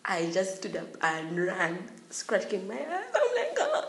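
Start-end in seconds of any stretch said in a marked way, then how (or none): 3.6-3.8 very high, loud howl; mock wail. Student crying after the caning, mock wail. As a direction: howl it high like someone being beaten.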